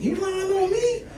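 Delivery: very high cracked whine of disbelief; squeaky disbelief. Retold or imitated. Young guy to the girl who lied, squeaky disbelief. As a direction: squeak it out in betrayed shock.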